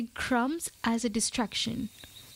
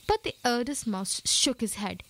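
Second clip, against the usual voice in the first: ordinary delivery, nothing marked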